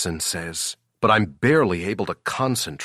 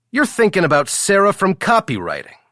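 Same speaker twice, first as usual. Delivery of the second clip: much higher, louder, fast; teasing coworker; 12 semitones higher, 6 dB louder, faster than usual. Acted. Jackson teasing Ethan about Sarah, high fast and loud. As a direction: needle him with a knowing grin.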